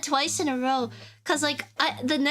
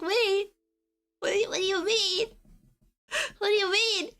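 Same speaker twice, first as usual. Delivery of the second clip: confused; 6 semitones higher than usual. Retold or imitated. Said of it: "And it's like".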